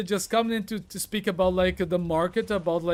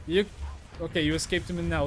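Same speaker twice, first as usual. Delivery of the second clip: ordinary talk, nothing marked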